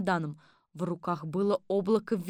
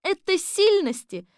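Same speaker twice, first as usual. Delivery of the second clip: high, loud, slow correction; high-voiced defence of the chief; Kolbochkina retorting; 12 semitones higher, 7 dB louder, slower than usual. A narrator's reading. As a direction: correct him emphatically, drawn out and pitched up. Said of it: "ответила Колбочкина".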